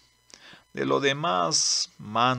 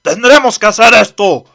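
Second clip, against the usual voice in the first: high, loud determined yell; desperate shout; 9 semitones higher, 16 dB louder than usual. Acted. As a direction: shout the last-ditch plan. Desperate decision in chase.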